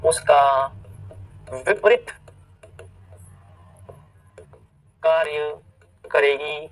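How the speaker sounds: word by word, long pauses between; slow dictation. Real, not acted. Teacher writing the rule in Hindi for students, word-by-word dictation.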